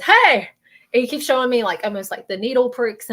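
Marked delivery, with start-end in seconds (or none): 0.0-0.5 high, loud attention-getting call; relayed shout of greeting. Retold or imitated. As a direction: call out brightly to get attention. Relays spirit calling out.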